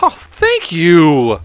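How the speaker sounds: high, gushing mock gratitude; gullible flattered person. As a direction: imitate someone naively thrilled by a compliment. Playing the flattered, oblivious insulted people.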